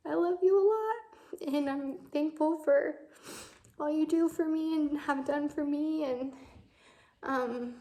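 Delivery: real tearful tightness, pitch up high; holding back tears, voice straining upward; emotional. Real, not acted. Granddaughter telling grandpa she loves him, choked up. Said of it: "I'm probably just emotional because I'm pregnant".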